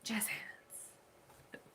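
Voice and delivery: whispered, very soft aside; playful whisper. Pretend. Woman capping a joke about standing out, playful whisper. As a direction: whisper it like a tiny flourish.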